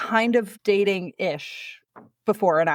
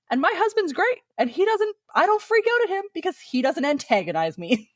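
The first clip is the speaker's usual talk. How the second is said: mock high voice; 9 semitones higher than usual. Mocking her own borderline defense.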